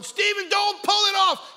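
high, slow, loud panicked mother's call; frantic stepmother. Retold or imitated. Preacher voicing the stepmother chasing Stephen, high and shrill-loud. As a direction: shriek after the running kid in a high voice. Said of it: "stepmother trying to catch him, going".

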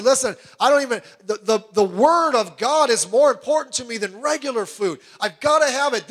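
Job in anguish. Retold or imitated. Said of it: "he said".

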